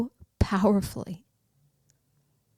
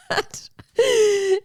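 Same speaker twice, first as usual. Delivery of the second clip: high, loud burst of laughter; 11 semitones higher, 3 dB louder than usual. Real, not acted. Mom laughing at the elf argument. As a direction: crack up at the story. Laugh after quarrel retold.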